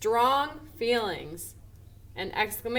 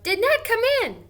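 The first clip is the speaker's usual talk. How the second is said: much higher and louder, bursting with excitement; excited question; 12 semitones higher, 7 dB louder than usual. Shown as a demonstration. Teacher showing the class an excited way to ask. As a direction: blurt it out excitedly. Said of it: "even when I say it excitedly".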